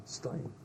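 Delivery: Stein as "Stoyn"; Brooklyn pronunciation. Retold or imitated. Explaining he's from Brooklyn, Stein said as "Stoyn". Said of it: "From Brooklyn, Stein".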